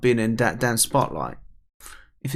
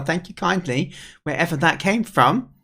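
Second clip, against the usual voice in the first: normal talking voice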